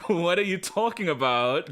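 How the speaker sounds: spelled-out "ha ha", then feigned bafflement; forced laugh through teeth. Retold or imitated. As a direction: imitate someone laughing off an accusation. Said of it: "Gritting his teeth".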